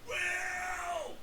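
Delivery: high startled exclamation; surprised. Real, not acted. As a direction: caught off guard, blurt it. Exclaims at the reveal.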